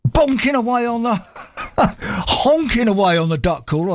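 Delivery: giggling through the punchline; laughing through a slip. Real, not acted. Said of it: "I nearly ruined it".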